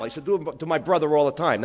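usual speaking voice

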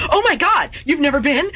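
high, eager, gushing partner; excited gushing. Retold or imitated. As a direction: gush like a thrilled fan. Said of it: "his response was".

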